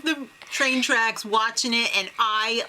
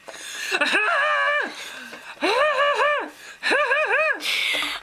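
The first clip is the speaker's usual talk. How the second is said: annoying laugh; 13 semitones higher than usual. Retold or imitated. Imagining two contestants hooking up, annoying laugh. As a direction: imitate an irritating, drawn-out laugh. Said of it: "she also has an annoying laugh".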